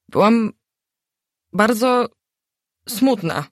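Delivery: a hint of whimpering through it; sniffling. A narrator's reading. Narrator tells Gośka her sad story, mildly sniffling. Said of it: "chlipnęłam".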